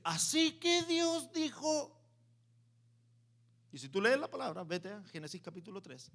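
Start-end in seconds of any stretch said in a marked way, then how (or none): 0.0-2.0 slow, high, sly serpent voice. Acted. Serpent tempting Eva, slow and sly. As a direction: draw it out like a tempting snake. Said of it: "Llega la serpiente donde Eva".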